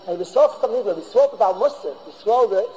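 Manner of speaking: normal talking voice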